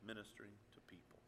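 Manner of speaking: unvoiced, fast whisper; sermon hushed to a whisper; whispered point. Real, not acted. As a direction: drop to a hush to land it. Soft closing point.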